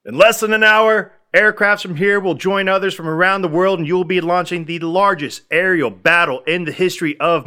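building to a roaring high climax; rousing presidential speech. Retold or imitated. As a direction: sell it like a president rallying pilots. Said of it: "the cheesiest presidential speech".

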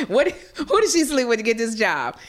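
high, loud mock-outraged demand; acted-out scandalized question; indignant disbelief. Voicing indignant women watching the show.

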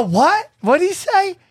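high, startled double take; surprised. Retold or imitated. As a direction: react like you can't believe it. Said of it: "I go".

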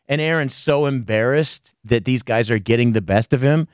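ordinary talk, nothing marked

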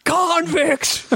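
high cartoon Aussie exclamation; mock Australian accent. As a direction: call it out in fake Australian.

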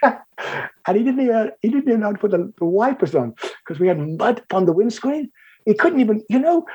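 high-pitched, laughing disbelief; amused, laughing. Real, not acted. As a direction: tell it through a laugh, voice riding up. Amused at the boy's driving.

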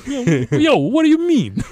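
hammed-up Brooklyn tough guy; brooklyn accent. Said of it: "very pronounced Brooklyn accent".

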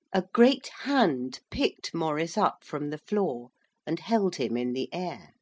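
plain reading voice

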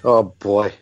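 normal talking voice

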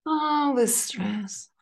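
drawn-out, slow repeat; very slow repetition. Shown as a demonstration. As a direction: stretch it out slowly. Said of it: "repeat after the guide".

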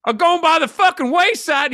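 high, worked-up Southern rant; mock political tirade in a Southern twang; passionate Southern accent. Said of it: "he was getting passionate, and the Southern accent".

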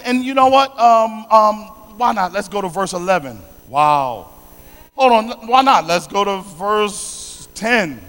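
normal talking voice

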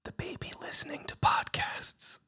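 hushed, fully unvoiced whisper; secretive stage whisper. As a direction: whisper so the baby doesn't wake. Hushed bit about not waking baby.

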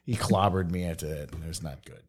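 low, fading deadpan; deadpan mutter. Real, not acted. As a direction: drop it flat and quiet. Deadpan after story.

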